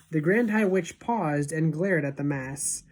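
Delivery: ordinary delivery, nothing marked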